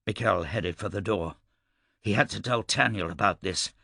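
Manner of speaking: plain reading voice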